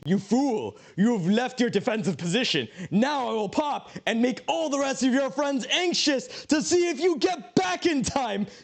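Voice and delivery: high, slow gloating voice for the game queue; scene: the game queue mocking a player who left, gloating villain; taunting villain queue. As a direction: play the queue as a smug cartoon villain, laughing at the end. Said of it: "Q's like".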